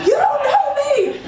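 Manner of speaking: slow, high-pitched indignant retort; re-enacted outburst, pitch way up; retold going off. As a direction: drag it out in high mock outrage. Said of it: "I went off on him".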